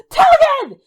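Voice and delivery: very high loud call; shouting. Acted. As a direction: yell his name to wake him. Dorian calls through door.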